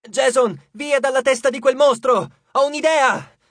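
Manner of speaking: high, loud urgent yell; urgent shout. Acted. Leo yelling to Jason over the monster, urgent shout. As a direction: shout over the battle noise.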